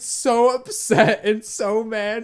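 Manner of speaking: loud, high, drawn-out emphasis; worked-up exclaiming. Retold or imitated. Telling a friend how upset she got, loud and exaggerated. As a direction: exclaim it in mock distress.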